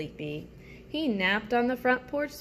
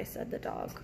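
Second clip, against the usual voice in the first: sleepy whisper; 9 dB quieter than usual. Acted. Sleepy dog tag whispered.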